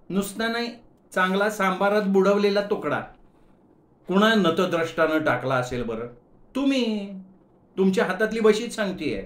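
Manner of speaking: put-on annoyed young woman. Shubha scolds Gaju about the vada in her hair, sharply. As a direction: tick him off while twirling.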